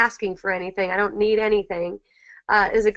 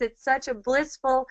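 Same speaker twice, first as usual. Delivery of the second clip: usual speaking voice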